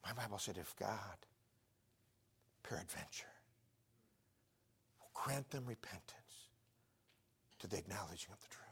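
very soft, near-whispered verse; hushed scripture quoting. Real, not acted. Preacher quoting his Bible on repentance, hushed.

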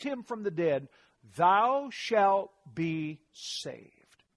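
slow, solemn Bible reading; scripture reading. Acted. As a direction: read the verse slowly, solemn. Reading Romans aloud.

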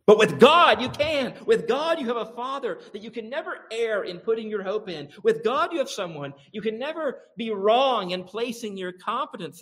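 high, ringing preacher's climax; preaching at full force. Real, not acted. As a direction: lift the congregation with certainty.

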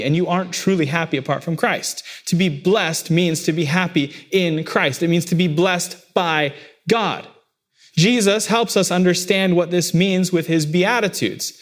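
ordinary talk

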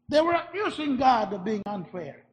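normal talking voice

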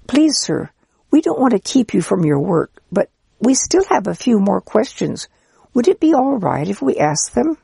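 put-on eager child's voice. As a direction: play a keen, polite little child. Children politely asking the priest.

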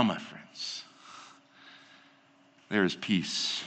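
low, soft and earnest, drawing the listeners in; hushed preaching. Acted. Preacher to his congregation about Christ's sacrifice, hushed and earnest. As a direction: speak of grace softly, close to the mic.

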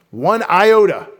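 iota said high and loud, pronounced eye-OH-tuh; stressed iota. Shown as a demonstration. Preacher teaching the congregation the word iota, punched loudly. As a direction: hit the word iota, falling at the end. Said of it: "we write I O T A".

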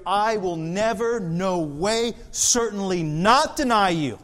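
never and not pushed high and loud; emphatic denial. Shown as a demonstration. Preacher stressing Peter's denial, high and loud. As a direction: hammer never and not hard. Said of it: "Emphatic double negative here".

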